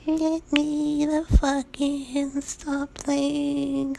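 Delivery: a thing faking human speech, stiff and unnatural; halting, weirdly toned voice. Retold or imitated. The creature outside the door pleading to be let in, in a high halting inhuman voice. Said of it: "It says in this halting, weirdly toned voice".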